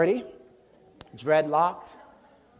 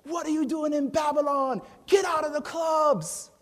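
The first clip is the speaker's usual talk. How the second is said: club preacher's shout; 11 semitones higher, 4 dB louder than usual. Acted. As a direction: call the crowd out like a street preacher. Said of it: "we'd be like, you know".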